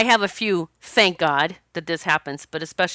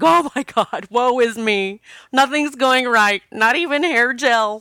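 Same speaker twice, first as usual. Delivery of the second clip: higher, louder, hammed-up self-pity; mock woe-is-me whining; 4 semitones higher, 5 dB louder than usual. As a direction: ham up your own tragedy. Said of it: "Then I was like".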